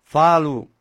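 usual speaking voice

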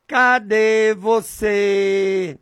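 slow, drawn-out call to God; slow prayer call. Shown as a demonstration. Preacher showing the radio audience how he calls out to God, slowly. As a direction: stretch each word slowly, like a prayer. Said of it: "Eu falo assim, desse jeito".

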